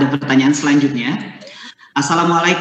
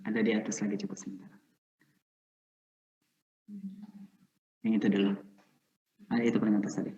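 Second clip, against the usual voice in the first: much softer, lower aside; quiet aside; 4 semitones lower, 13 dB quieter, faster than usual. Real, not acted. Host asks helper to scroll up for the questions, quiet aside. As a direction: talk low to a helper while searching.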